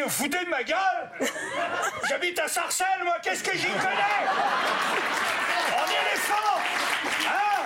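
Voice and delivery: indignant protest. Retold or imitated. Suspect protests to police chief.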